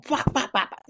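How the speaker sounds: high, quick popping bops, imitating a master's hand strikes; mock strike sounds. Telling a friend how the grandmaster flicked at his nose, high mock bops. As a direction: pop out each bop like a flurry of quick hits.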